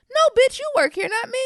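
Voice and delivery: high, indignant retort; mock snap at airport staff; sassy snap. Real, not acted. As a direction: put them in their place. Snapping at staff.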